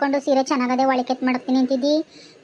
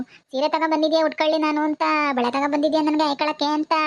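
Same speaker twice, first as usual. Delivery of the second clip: put-on wailing. Widow puts on grief for her visiting sister-in-law, mock wailing. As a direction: fake a widow's keening. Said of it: "ಸ್ವಲ್ಪ ಚೆನ್ನಾಗಿ drama ಮಾಡ್ಬೇಕು ಇವಾಗ".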